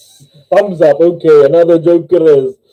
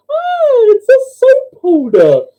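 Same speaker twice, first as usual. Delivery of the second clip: drawn-out falsetto wonder; amazed joke nun; 3 dB louder, slower than usual. Acted. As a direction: squeal the discovery in a high nun voice.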